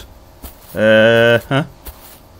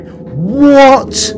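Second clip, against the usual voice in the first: high surprised what; 9 semitones higher than usual. Real, not acted.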